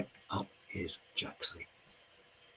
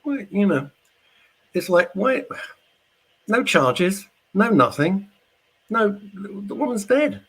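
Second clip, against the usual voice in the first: indignant rant; 10 semitones higher, 4 dB louder than usual. Real, not acted. Angry rant about unpunished death.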